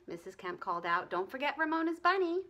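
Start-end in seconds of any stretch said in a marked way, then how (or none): 1.1-2.4 calling out. Acted. Mother calling out to son.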